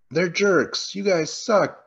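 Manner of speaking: high-pitched imitated jeer; mock taunting. Campers taunting their rivals, mock jeering. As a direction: jeer like a kid at a rival team. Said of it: "this moment where they're like".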